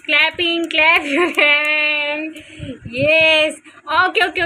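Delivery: high, loud sing-song coaxing. Real, not acted. Mother asking baby Diya to clap and raise hands, in high loud sing-song.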